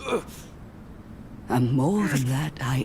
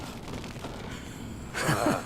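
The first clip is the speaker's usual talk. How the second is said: scoffing laugh; 8 dB quieter than usual. Acted. She scoffs at his greed.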